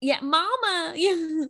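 put-on high Southern little-kid voice; Southern accent. Imitating a Southern kid answering mama, high Southern accent. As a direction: imitate a small child with a Southern drawl. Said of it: "like, a really good Southern accent".

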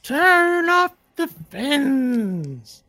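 high, very slow echoing repeat; mock the request, drawn out; eerie creature voice.

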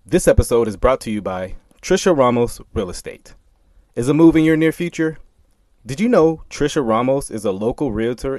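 upbeat, loud sponsor read. Acted. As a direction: sell it like a radio spot.

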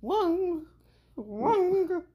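high mocking Wang; mocking imitation. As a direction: imitate her squeaky Wang. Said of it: "That's how you sound".

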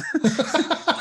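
bursts into high laughter; short high-pitched laugh. Real, not acted. Laughing at own story.

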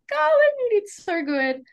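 high, bright burst of pride; excited praise. Retold or imitated. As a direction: gush over the young pitcher. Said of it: "I was like".

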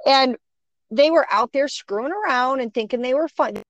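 ordinary talk

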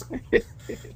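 short breathy laugh; quick unvoiced chuckle. Real, not acted. Laughing while retelling.

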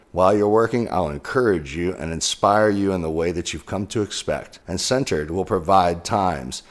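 loud, upbeat ad read. Acted. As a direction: pitch it like a sponsor spot. Sponsor ad read for Centered.

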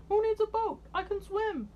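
high, bright-eyed boast; playful dinosaur. A narrator's reading. Dinosaur boasting to Danny, high and playful. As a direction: brush off the worry, chuffed. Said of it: "said the dinosaur".

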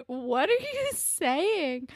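high, drawn-out mock bewilderment; baffled. New Jerseyan reacting to confusing accent, exaggerated bafflement. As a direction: stretch it out slowly in baffled disbelief.

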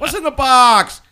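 loud dramatic repeat; movie quote. Retold or imitated. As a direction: shout the famous line. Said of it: "I'm reminded of the movie Seven".

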